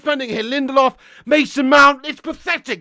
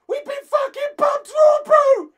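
rising shout; 11 semitones higher than usual. Real, not acted. Fan repeating the scoreline complaint as a high yell. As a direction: throw it up into a yell.

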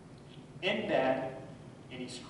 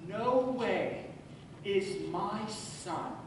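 each word weighed and growing; slow building vow; 3 semitones higher, much slower than usual. Retold or imitated. Father resolving his son will know who he is, slow and building.

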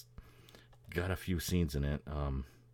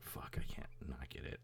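whispered; 3 semitones lower, 9 dB quieter than usual. Retold or imitated. Quoting own thought.

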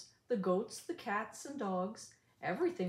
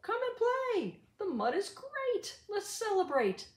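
much higher, louder happy shouting; joyful call; 12 semitones higher, 4 dB louder than usual. Acted. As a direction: call the animals over with delight. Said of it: "called out".

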